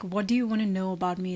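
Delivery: ordinary talk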